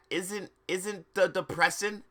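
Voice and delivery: ordinary delivery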